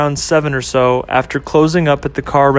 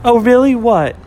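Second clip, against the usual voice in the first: put-on higher, curious girlfriend voice; girlfriend Ashley; 8 semitones higher than usual. Girlfriend asking about his day, higher and curious. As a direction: perk up and ask, pitched high.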